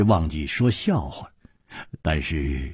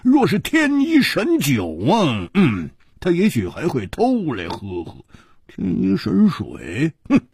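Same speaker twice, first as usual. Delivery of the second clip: 蝴蝶花 scoffing; 10 semitones higher than usual. Acted. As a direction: scoff in a high, exaggerated voice with grunts. 蝴蝶花角色腔. (高亢夸张地不屑，带哼声)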